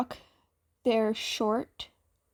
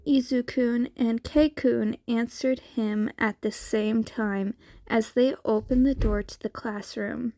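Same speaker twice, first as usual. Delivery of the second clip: ordinary delivery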